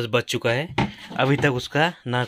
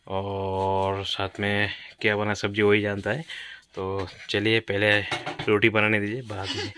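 ordinary delivery

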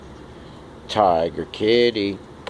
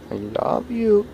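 higher coaxing demo; 13 semitones higher than usual. Shown as a demonstration. Owner coaxing cat to repeat, high cooing. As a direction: coo it up high to coax the cat. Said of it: "Come on".

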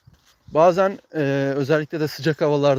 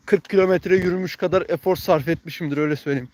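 ordinary delivery

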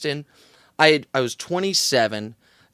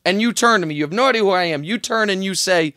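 normal talking voice

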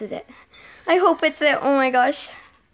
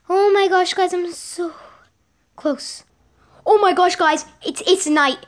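excited; 6 semitones higher, 3 dB louder than usual. Real, not acted. Last task finally arrives.

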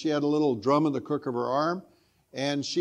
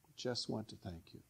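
repeats the words very softly; quiet repeat; 4 semitones lower, 17 dB quieter than usual. Shown as a demonstration. Preacher echoing the translation softly to the congregation. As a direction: let it fall to a hushed echo. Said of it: "It translates".

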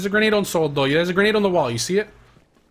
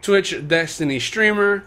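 low, measured voice-over; unhurried, low-key storyteller setup; video narration; 5 semitones lower, 5 dB quieter than usual. Real, not acted. Voice-over introducing a gameplay clip.

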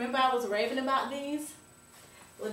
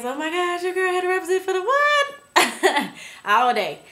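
excited; 9 semitones higher, 7 dB louder than usual. Real, not acted. Hyped singing for [name].

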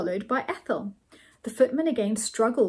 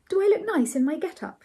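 higher-pitched character voice for Ethel; Ethel's voice; 7 semitones higher than usual. A narrator's reading. Ethel asks about her get-up, higher-pitched voice. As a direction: lift the pitch for Ethel's question.

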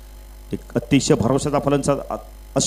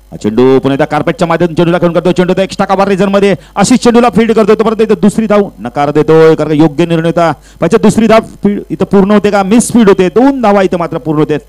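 loud, raised, racing cricket commentary; excited play-by-play; 4 semitones higher, 8 dB louder than usual. Real, not acted. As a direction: call the running between wickets urgently as it happens. Live play-by-play at full pitch. (उत्साही, जोरदार cricket समालोचन)

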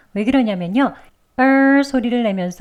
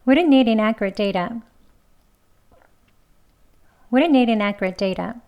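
quicker, linked flow; fast; slower than usual. Shown as a demonstration. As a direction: run the sentence smoothly. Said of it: "이번엔 빨리".

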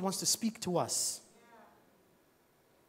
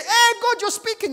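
excited discovery; 9 dB louder than usual. Pretend. Acted surprise at hearing God.

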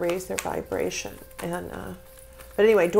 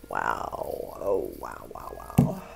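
hushed, lower awestruck wows; breathy awe; slower than usual. Real, not acted. Awed reaction to the cards.

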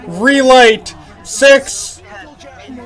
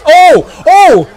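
surprised yelp; 6 semitones higher, 6 dB louder than usual. Real, not acted. Ship splashes down.

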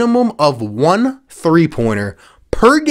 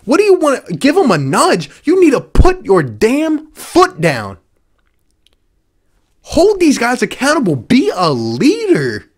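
fired-up rant; 5 semitones higher than usual. Real, not acted. Ranting at coach.

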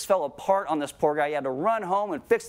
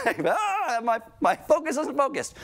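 high, hammed-up frazzled complaint; mock the scattered mind; flustered self-talk; 8 semitones higher than usual. Preacher mimicking a distracted worshipper, high flustered whine.

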